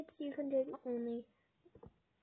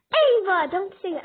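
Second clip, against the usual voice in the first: loud sharp scolding; scolding shout; 6 semitones higher, 18 dB louder than usual. Real, not acted. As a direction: shout her down to stop. Parent scolding rude child.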